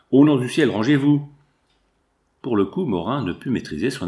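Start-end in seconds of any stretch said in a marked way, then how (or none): 0.1-1.3 loud, urgent cry to get out of the way; loud desperate plea; alarmed plea. Acted. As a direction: beg him to move, loudly. Panicked plea as the hunter aims.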